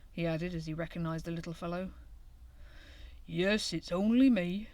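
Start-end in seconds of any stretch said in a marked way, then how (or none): 3.3-4.7 slow, lightly voiced tired old hedgehog; a hint of a worn-out mother; slow weary reply from the cold; weary grunt. A narrator's reading. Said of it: "grunted Missus Hedgehog wearily".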